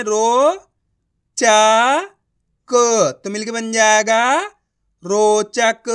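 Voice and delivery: "रो", "चा", "क" each called out loudly with pauses, then "रोचक" joined; teacher calling out "रो" "चा" "क" one by one; syllable by syllable. Shown as a demonstration. Teacher showing students to build words, loud syllable calls. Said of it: "तो रा को अपन कैसे पढ़ेंगे?".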